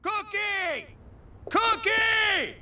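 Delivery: calling out. Acted. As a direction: call her name across the room. Calling out for Cookie.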